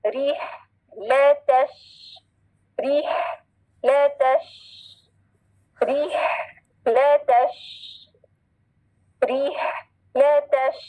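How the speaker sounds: broken into री, ले, तश with a pause after the breathy h; model syllables. Shown as a demonstration. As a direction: hold the sh of तश. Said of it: "अब गौर से सुने".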